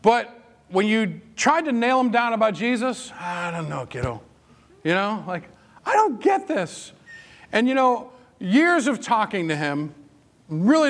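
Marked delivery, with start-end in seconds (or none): 3.2-4.2 soft, offhand brush-off; lightly voiced stepfather dodging the question; dismissive stepfather. Stepfather brushing off talk of Jesus. 5.9-6.9 high-pitched mock bewilderment; acted bafflement. Acted bafflement in retold talks.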